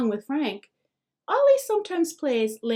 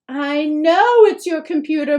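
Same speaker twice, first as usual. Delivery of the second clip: much louder, higher frantic shout; a mother losing control; hysterical cry; 5 semitones higher, 10 dB louder than usual. A narrator's reading. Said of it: "Mom cries hysterically".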